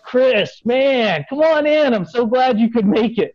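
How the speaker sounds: high, big-hearted greeting in imitation; acted-out exuberant hello; effusive welcome. Acting out host's big open-armed greeting.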